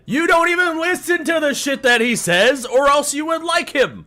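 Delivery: loud, high, heated outburst; angry rant. Real, not acted. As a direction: yell it at the critics. Ranting at critics.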